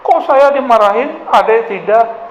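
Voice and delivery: put-on high-pitched protest of a jealous child; mock jealous. Older child protesting being scolded unlike younger sibling, jealous. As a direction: imitate a sibling complaining, pitch jumping up.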